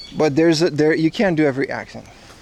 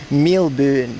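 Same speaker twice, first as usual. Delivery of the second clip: Melbourne as milben, New Zealand vowels; New Zealand accent; 3 semitones lower than usual. Shown as a demonstration. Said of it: "That's how New Zealand people say it".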